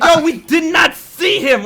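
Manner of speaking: high, loud, drawn-out protest; protesting shout. Retold or imitated. Friend retelling his defence about Ben's tweet, exasperated shout. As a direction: shout your innocence, hammed up.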